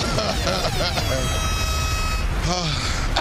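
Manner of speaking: low drawn-out laugh; long laugh. Real, not acted. Host laughs.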